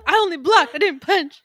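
high, loud pleading to Mom through laughs; hammed-up childish plea; whiny son. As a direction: protest your innocence like a kid. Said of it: "Telegonus voiced to his mother".

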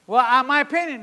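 high, loud, whiny mimic of a know-it-all; mocking quote. Preacher mocking people who push their own opinion, high loud whine. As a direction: lampoon the self-important opinion-giver. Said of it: "Doctrines of devils come when people say".

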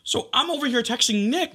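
ordinary talk, nothing marked

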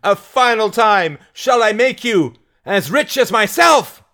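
high, loud final appeal; shouted challenge. Acted. Mary asking the crowd a last time, high shout. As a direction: build the crowd up for one last answer.

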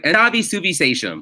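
mocking a refusal to do the Malayalam accent; cousin without Malayalam accent. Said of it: "she doesn't want to do the accent".

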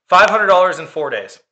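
Five punched high and loud, then falling; emphatic repeat. Real, not acted. Repeating the cost to co-host, punched emphasis on Five. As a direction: stab the word Five and let it drop.

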